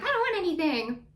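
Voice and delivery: high, quick mimicked retort; retold outburst. Mimicking Tamara refusing Heather's gumbo, high quick retort. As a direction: toss it out high and fast. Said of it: "when she finally says, like".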